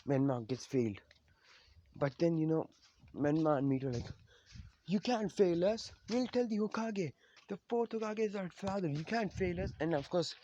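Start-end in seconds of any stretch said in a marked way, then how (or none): 4.9-9.7 indignant protest. Retold or imitated. Kids telling Kakashi he can't fail them, high indignant protest. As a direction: protest in a raised voice, threatening to tell. Siblings protest failing, threaten to tell.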